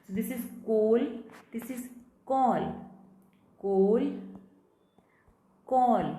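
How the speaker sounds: coal with a rounded oh, call with an open aw; contrast demo. Shown as a demonstration. Teacher contrasting coal and call for students. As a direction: coal and call set clearly apart. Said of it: "the difference between the way these words are spoken".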